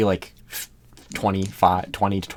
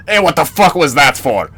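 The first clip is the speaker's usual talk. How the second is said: loud, raised-pitch outraged holler; indignant yell; 5 semitones higher, 9 dB louder than usual. Acted. Player yelling at the fighters who poofed Igor, indignant yell. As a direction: yell it across the battlefield in protest. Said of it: "I'm just gonna fucking yell".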